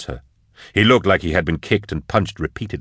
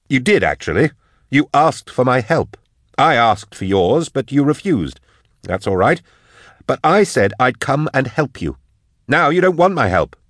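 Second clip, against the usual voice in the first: high-pitched, indignant reproach; aggrieved Drusha; 7 semitones higher, 3 dB louder than usual. Acted. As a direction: argue back in a raised voice. Voices Drusha arguing with Eisenhorn.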